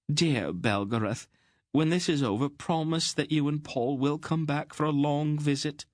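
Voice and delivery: worried plump queen pleading; fretful little queen. A narrator's reading. Queen begging Aunt Paul to keep the king safe, fretful.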